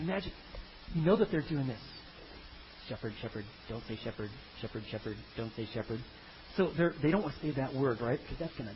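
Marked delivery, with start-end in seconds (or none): 2.9-6.0 much lower and much softer, muttering; "shepherd" repeated under the breath as a warning; nervous rehearsal. Retold or imitated. Nervous brothers drilling themselves not to say shepherd, very soft and low.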